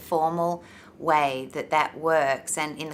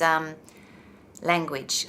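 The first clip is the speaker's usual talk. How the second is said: ordinary talk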